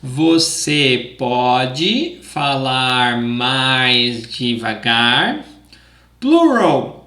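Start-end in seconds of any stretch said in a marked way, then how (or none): 0.0-5.4 drawn-out and deliberate; slow it way down for learners; slow, careful model. Shown as a demonstration. Teacher showing the phrase slowly so students can repeat. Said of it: "Again, and try to repeat".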